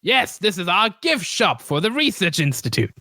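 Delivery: high-pitched put-on shopkeeper; shopkeeper character voice. Game master as shopkeeper explains the shop, high put-on voice. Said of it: "trying to find this guy's voice".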